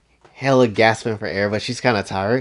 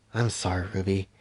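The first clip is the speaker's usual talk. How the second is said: soft apology; 3 semitones lower, 9 dB quieter than usual. Acted. Weiss apologizes softly to herself.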